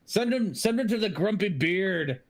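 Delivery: a gnome suggesting the tavern with glee; lightly voiced gnome. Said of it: "one of them's like".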